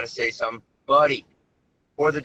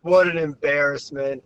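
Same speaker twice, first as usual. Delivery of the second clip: mortified; 4 semitones higher, 4 dB louder, slower than usual. Retold or imitated. Caller mortified.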